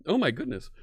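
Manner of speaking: ordinary talk, nothing marked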